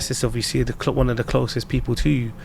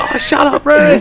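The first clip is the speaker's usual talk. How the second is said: high, loud imitation of a fed-up partner; mock annoyed retort; 13 semitones higher, 8 dB louder, slower than usual. Missus answering her husband's teasing, mock annoyed. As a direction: throw it back in playful irritation. Missus snaps back in retold banter.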